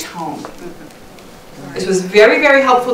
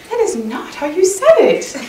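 higher-pitched mock protest, half laughing; objecting husband; 8 semitones higher than usual. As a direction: imitate a husband calling out his wife's tone. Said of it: "he'll be like".

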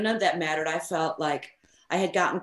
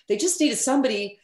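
ordinary talk, nothing marked

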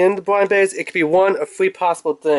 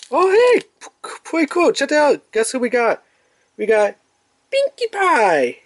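high-pitched excited discovery; thrilled, high unboxing reveal; 9 semitones higher than usual. Real, not acted. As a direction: announce the surprise find, stretching out the name at the end. Revealing the blind bag's surprise.

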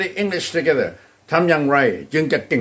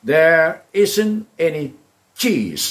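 very drawn out, ISN'T stressed; slow it down for students; slow teacher model; slow model sentence; 3 semitones higher, much slower than usual. Shown as a demonstration. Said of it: "ให้พูดว่า".

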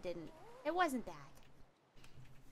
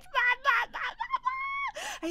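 mock babble; 3 dB louder, slower than usual. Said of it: "mock babble".

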